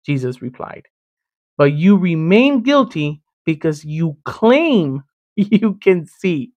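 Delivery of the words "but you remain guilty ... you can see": drawn-out accusation, lingering on key words; slow, pointed. Acted. Preacher voicing Jesus's charge to the Pharisees, slow and pointed. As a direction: stretch it out so the charge lands.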